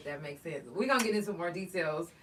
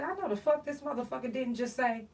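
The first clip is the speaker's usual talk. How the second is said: indignant; 4 semitones higher than usual. Retold or imitated. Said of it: "and I said".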